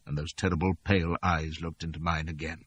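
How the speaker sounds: plain reading voice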